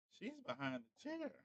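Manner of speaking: high, soft, slow coo; cooing pet talk. Real, not acted. Cooing over Bella behind the chair.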